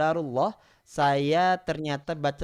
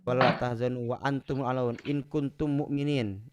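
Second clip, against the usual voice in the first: Quran recitation; 4 semitones lower, 4 dB quieter than usual. Acted. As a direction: recite it like tilawah.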